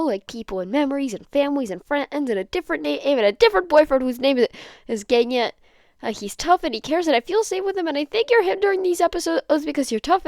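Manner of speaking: high, loud, rushing tearful outburst; tearful rant. Acted. Denki pouring out his episodes to Hitoshi in a high tearful rant. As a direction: let it all spill out, near sobbing. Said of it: "Denki lets it all bubble out".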